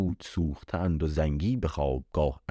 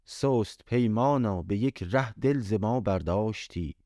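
recited verse; 4 semitones higher than usual. A narrator's reading. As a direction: linger over each line like a lament. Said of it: "آنگاه این دو بیت برخواند". (شعرخوانی آرام و سوزناک)

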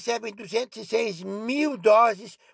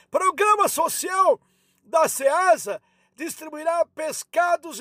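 imitating grandma; 6 semitones higher than usual. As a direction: shout the news like a squawky granny. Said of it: "Tá tentando me imitar".

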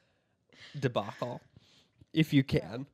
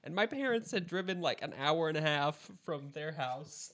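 usual speaking voice

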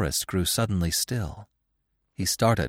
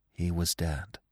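usual speaking voice

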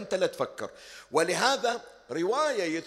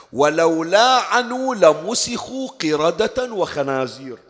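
louder, solemn recitation, slowing on the last line; full-force preaching; 8 dB louder than usual. Acted. Preacher recites the Prophet's words to the crowd, loud and solemn. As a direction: proclaim the hadith from the pulpit.

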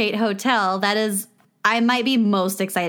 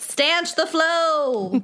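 slow, higher, louder order; slow mock command; 6 semitones higher, 4 dB louder, much slower than usual. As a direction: bark it like a mock emergency.

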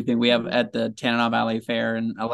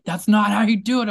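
higher, louder mock scolding; mad grandpa, retold; 9 semitones higher, 7 dB louder than usual. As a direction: imitate a grandpa telling off a kid.

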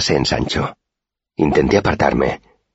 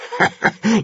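high jeering laugh; mocking boy's laugh. A narrator's reading. A gang boy laughing at the narrator, high and mocking. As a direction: laugh at the victim's excuse. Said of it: "rió".

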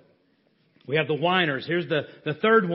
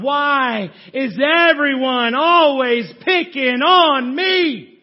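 lightly voiced whiny Charlie Brown, higher and louder; 4 semitones higher, 5 dB louder, much slower than usual. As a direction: whine it like Charlie Brown feeling picked on. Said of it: "Charlie Brown".

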